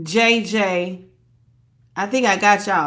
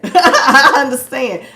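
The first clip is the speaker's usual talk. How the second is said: laughing; 4 semitones higher, 7 dB louder, slower than usual. Real, not acted. Laughs while saying it.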